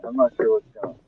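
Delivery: ordinary talk, nothing marked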